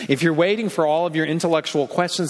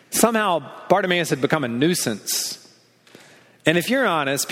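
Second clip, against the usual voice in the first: ordinary talk, nothing marked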